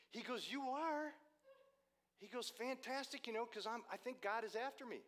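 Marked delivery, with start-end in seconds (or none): 0.5-1.1 very high, loud astonished question; amazed stranger. Retold or imitated. Stranger on learning the driver is a preacher, high and amazed. 2.5-5.0 high, eager outpouring; thrilled stranger. Retold or imitated. Stranger to preacher changing tires, high and thrilled.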